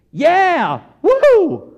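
loud, high whooping; cheering fan. Acted. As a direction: whoop like a parent in the stands. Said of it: "in the stands cheering on your kids".

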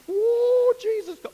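high, loud mock cheer; hammed-up church whoop. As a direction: burst out with a fake whoop.

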